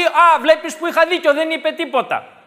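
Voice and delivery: 1 gloating opponent. Retold or imitated. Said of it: "να θεωρήσει και να πει".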